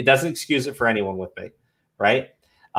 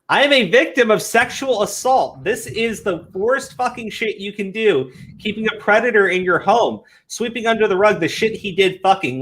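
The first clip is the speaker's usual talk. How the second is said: higher, hammed-up indignant reading; mock outraged tweeter; 9 semitones higher than usual. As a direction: parody the offended poster.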